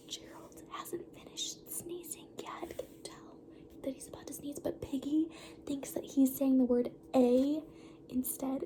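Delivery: soft whisper to listeners; whispering aside. Real, not acted. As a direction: lean in and whisper the secret.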